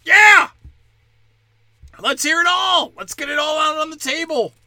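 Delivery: high, loud shouted rant; enthusiastic shout. Real, not acted. Comic demanding everything be aired, shouting. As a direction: shout it to the room.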